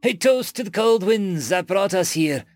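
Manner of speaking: high, ringing declaration to the room; proclaimed toast. A narrator's reading. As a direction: raise the voice to propose a rousing toast. Human raises glass for a toast.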